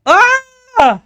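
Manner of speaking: high piercing scream; shrill scream. Acted. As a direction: shriek in fright. Scream at monster.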